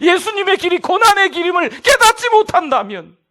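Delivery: fiery sermon delivery; full-force preaching. Acted. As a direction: preach it hard to the congregation.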